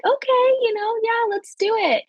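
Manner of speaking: chirpy go-along yes; high eager agreement. Retold or imitated. Mother-to-be agreeing to the nurses, high and eager.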